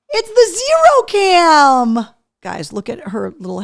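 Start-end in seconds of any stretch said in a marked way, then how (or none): 0.1-2.1 slow excited announcement. Real, not acted. Announcing the dog cam.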